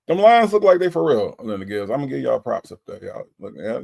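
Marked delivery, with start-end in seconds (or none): 0.1-1.3 higher, louder hype for the Lions; excited praise. Real, not acted. Host hyping the Lions to a Detroit fan, excited. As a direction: hype it up for the team.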